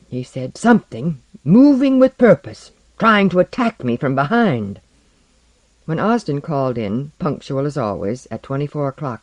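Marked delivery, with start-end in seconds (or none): 0.6-4.8 raised pitch and volume, urgent; worked-up, emphatic character voice; agitated insistence. A narrator's reading. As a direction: insist on what attacked you, agitated. Frightened Porlock insists on his encounter.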